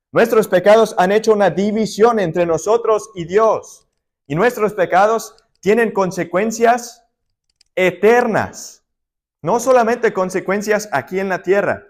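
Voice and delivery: preacher pressing on the eternal consequences of sin; preaching at full force. Acted. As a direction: drive the point home to the congregation.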